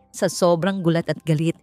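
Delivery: ordinary delivery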